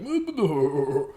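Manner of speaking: mock cartoon-character laugh, drawn out; imitated weird laugh. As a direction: imitate the kid's odd hooting laugh. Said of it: "he would laugh and be like".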